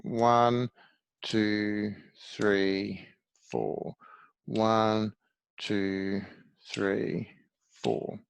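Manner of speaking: slow, evenly spaced counting; measured beat-by-beat count, falling on four. Shown as a demonstration. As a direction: count out a steady slow pulse. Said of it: "let's go a bit slower".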